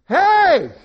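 high, very loud squawked call; parrot's yell. Acted. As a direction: yell out again as the pesky parrot. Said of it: "and there again".

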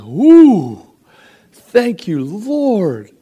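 high, loud joyful whoop; excited praise. Retold or imitated. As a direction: burst out in delighted thanks. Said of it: "I'm like".

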